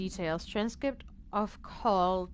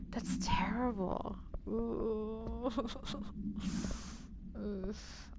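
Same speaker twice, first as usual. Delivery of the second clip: whispered disgust; 10 dB quieter, much slower than usual. Real, not acted. Reacting after creepy audio log.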